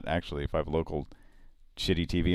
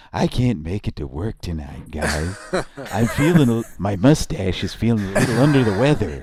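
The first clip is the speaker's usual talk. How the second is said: thirty-four guy impression; 2 semitones higher, 6 dB louder than usual. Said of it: "impersonate the thirty-four guy".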